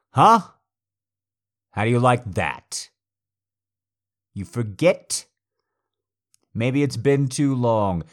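loud, high threatening shouts; shouting. Acted. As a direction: snarl it with rising menace. Escalating dominance, shouting.

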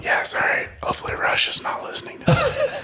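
hushed, conspiratorial joke whisper; mock-secret whisper. Podcaster joking that Russia may be listening, whispered. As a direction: whisper as if spies might overhear.

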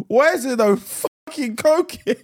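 high, drawn-out indignant yell; acted outburst. Acting out an outraged outburst.

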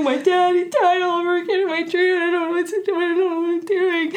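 high, teary voice, laughing through it; tearful quote. Retold or imitated. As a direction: replay the sobbing words with a laugh. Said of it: "I started crying".

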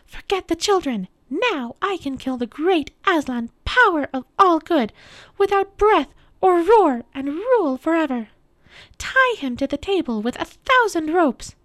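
high witch voice rising to loud triumph; gloat, then command in a high voice. A narrator's reading. Witch gloats and orders her servants.

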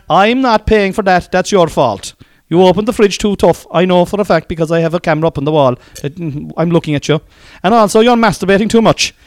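loud, high Kerry landlord; Kerry accent. Retold or imitated. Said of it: "they're great business people".

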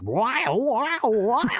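high, rising wows into a laugh; a playful imitation of a screaming singer; mock screaming wow. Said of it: "the letters are just like".

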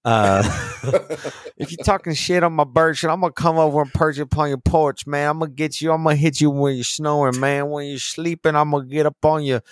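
high-pitched, rapid comic threats; playful hammed-up tough talk; mock trash talk. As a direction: talk smack in a high, run-on rush. Mock trash talk about birch puns.

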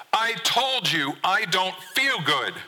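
slow, high-pitched, drawn-out protest; angry yell. A narrator's reading. Son yells at dad from under covers, angry. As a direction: hammer it out in a high, upset yell. Son shouts back from bed.

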